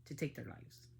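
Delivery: seething under the breath; bitter whisper. Real, not acted. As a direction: drop to a hard whisper. Angry rant at the father.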